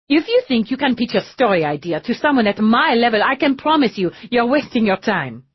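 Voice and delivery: high, loud hurried protest; agitated impatience. A narrator's reading. Lisette pressing the detectives while rushing to a meeting, agitated. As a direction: fret about the meeting.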